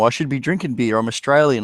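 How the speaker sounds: ordinary talk, nothing marked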